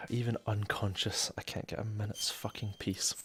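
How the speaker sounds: quiet, fed-up grumble; annoyed mutter. Real, not acted. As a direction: grumble it under your breath. Grumbling after a sigh.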